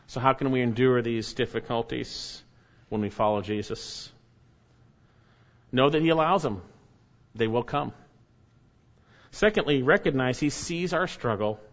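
ordinary talk, nothing marked

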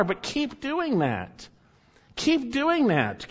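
slow insistent urging; emphatic repetition. Shown as a demonstration. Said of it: "The implication is".

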